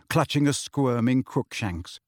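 usual speaking voice

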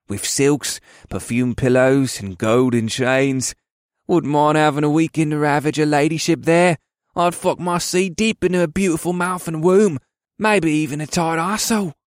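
leering soldier, lingering then rising in pitch; lewd smirk. A narrator's reading. Dark-haired elf boasting to fellow soldiers, smirking lewdly. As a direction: relish the crude fantasy with a smirk. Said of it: "the dark-haired elf smirked".

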